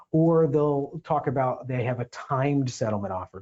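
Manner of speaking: usual speaking voice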